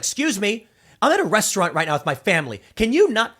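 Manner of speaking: lightly acted huffy star brushing off a fan; indignant celebrity. Celebrity interrupted at family dinner by a fan, indignant.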